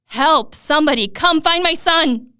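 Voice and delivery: loud, high desperate scream; screaming for help. Acted. Father searching the bloody nursery for his son, desperate scream. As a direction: yell it with everything, panicked.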